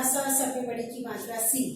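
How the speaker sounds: सी cut short, with a brief i; short vowel mistake. Shown as a demonstration. Teacher replays her deliberate mistake, सी said with a short vowel. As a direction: end on a quick short सी. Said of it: "ये छोटी की मात्रा पढ़ने का तरीका है".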